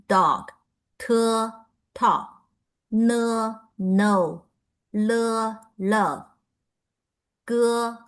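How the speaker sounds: put-on each pinyin syllable "Bo" said clearly, then its English example word "boy"; reading consonants with examples. Shown as a demonstration. Teacher reads consonants with English example words. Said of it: "I will read these for you".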